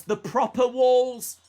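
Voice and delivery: loud, slow excited call; excited shout. Real, not acted. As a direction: bellow the hold name with joy. Excited at the hold.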